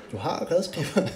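ordinary delivery

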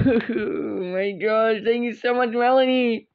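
happy crying. Acted. Serena thanks her friend, crying happily. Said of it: "I made her cry in a good way".

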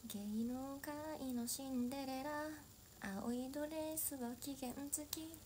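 a soft, dreamy Cinderella verse ending in a whisper; dramatic recitation. Pretend. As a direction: recite it like a wistful stage monologue. Dramatic poetic Cinderella monologue.

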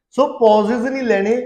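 usual speaking voice

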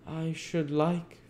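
high, slow, hesitant voice; faltering child. A narrator's reading. Dick daring to ask for something, faltering. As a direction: venture it timidly and slowly. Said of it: "faltered the child".

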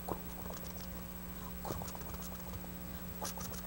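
very soft, voiceless throaty clicking; imitated stomach rumble. A narrator's reading. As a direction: make a quiet gurgling belly noise. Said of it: "le empezó a crujir la tripa".

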